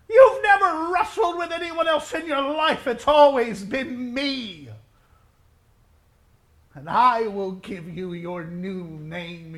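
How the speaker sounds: loud, slow, ringing preaching; preaching at full force. Acted.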